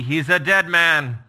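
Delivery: loud, slow pronounced doom; a king swearing death; deadly threat. Acted. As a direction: spell out the death sentence. Paraphrasing Saul's threat.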